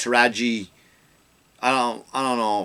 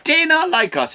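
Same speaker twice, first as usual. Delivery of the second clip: much higher, slow mock imitation; high mocking parody of her line; 10 semitones higher, slower than usual. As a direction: ham up her phrasing. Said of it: "she says about these".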